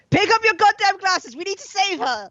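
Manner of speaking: high, urgent in-character shout. Acted. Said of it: "while shouting at Marco".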